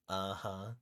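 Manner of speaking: lightly voiced raccoon attempt at speech; a rising animal attempt at human agreement; raccoon imitating human. Raccoon bluffing a banker on the phone, imitating a human. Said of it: "imitate the sound of a human".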